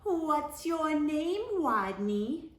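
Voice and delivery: high, slow taunting classmates; high mocking schoolkid voice; teasing rodents. A narrator's reading. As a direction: sing it out mockingly like a playground bully. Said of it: "asked the other rodents".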